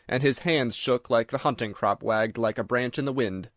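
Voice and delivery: ordinary delivery, nothing marked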